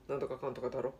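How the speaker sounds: low, soft grumbled mutter. Retold or imitated. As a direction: mumble it under your breath. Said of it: "ボソボソ言ってて".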